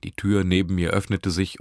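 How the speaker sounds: ordinary delivery, nothing marked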